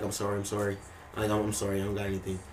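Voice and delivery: mildly scared, hushed retelling; retold scared apology. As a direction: imitate someone backing off, softly and meekly. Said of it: "acting really scared and weird".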